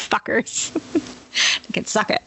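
muttered insult. Real, not acted.

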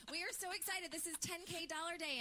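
bright, high, bubbly show opener; high-pitched excited podcast intro. Real, not acted. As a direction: kick off the show with big energy.

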